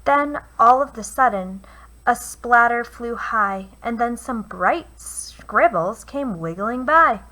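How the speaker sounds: put-on lively read-aloud for children; storyteller voice for kids; picture book reading. A narrator's reading. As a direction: read it like bedtime rhymes. Reads a rhyming picture book.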